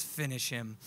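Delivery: low, soft Mortal Kombat voice; a menacing video game command; video game announcer. Retold or imitated. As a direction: intone it like the game's announcer. Mortal Kombat line in his mind.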